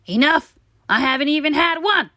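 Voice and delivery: loud, fast, outraged; indignant tooth. Acted. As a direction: protest back at Stuart. Tooth argues back.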